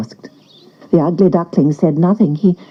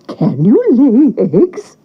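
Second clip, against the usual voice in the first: put-on slow, higher old woman voice; old woman asking; 4 semitones higher, 4 dB louder, slower than usual. A narrator's reading. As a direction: ask it like a doubtful old woman. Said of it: "asked the old woman".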